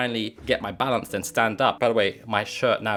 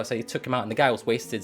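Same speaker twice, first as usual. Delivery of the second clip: usual speaking voice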